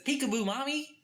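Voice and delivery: put-on high, sing-song little-boy ghost calling out; creepy ghost child. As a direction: tease like a creepy kid ghost hiding in the fireplace. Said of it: "he hangs out in the fireplace and says".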